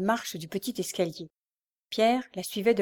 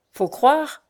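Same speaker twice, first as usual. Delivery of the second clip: grumbling; 6 semitones higher, 6 dB louder than usual. A narrator's reading. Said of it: "grommela la mégère".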